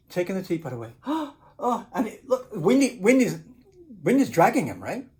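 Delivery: ordinary delivery, nothing marked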